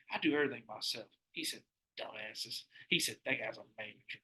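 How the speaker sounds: low, soft grumble; retold grumbling. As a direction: mumble it under your breath. Retold muttering of old employee.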